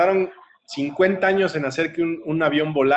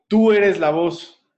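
louder, higher and slowly spaced; forceful affirmation; 5 semitones higher, 5 dB louder, much slower than usual. Retold or imitated. Coach empowering a frightened girl, forceful and slow. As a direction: drive it home like a motivational coach.

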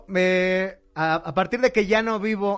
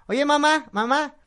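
put-on high, louder kid voice calling mom; imitated child, pitched up; curious little boy; 9 semitones higher, 4 dB louder than usual. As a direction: pester your mother with a child's question. Child questions his mother in joke.

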